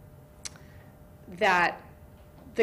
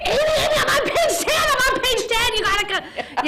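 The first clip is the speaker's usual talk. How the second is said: put-on high, loud, laughing teen voice; breathless excited shouting; excited girl; 6 dB louder than usual. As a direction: squeal it like a thrilled kid. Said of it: "she'd be like".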